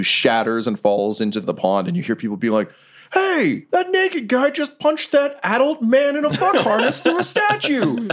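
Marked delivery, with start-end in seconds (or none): 3.1-8.1 high, startled bystander shout with a laugh in it; shocked bystander. Acted. Shocked bystander yelling at the naked guy. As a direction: yell it like a gawking onlooker.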